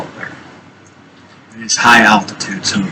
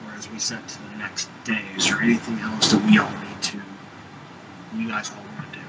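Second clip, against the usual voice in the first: quiet wrap-up; 3 semitones lower, 14 dB quieter than usual. Real, not acted. Game master winding down session.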